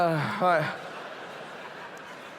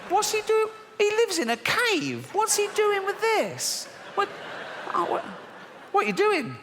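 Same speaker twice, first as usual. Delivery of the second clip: baffled disbelief; 14 semitones higher than usual. Real, not acted. Baffled by Bible pictures.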